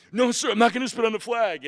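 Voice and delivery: high, very loud retold refusal; tearful defiance. Recruit with tears in his eyes defies the instructor, shouting. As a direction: shout the refusal at full force. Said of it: "I got tears in my eyes".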